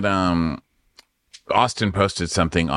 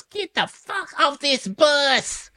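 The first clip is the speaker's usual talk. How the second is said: high, slow mock dismissal; mocking Madison; much slower than usual. Madison ordering Shep off the bus, slow high mock. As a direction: drag it out high and cold. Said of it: "And Madison goes".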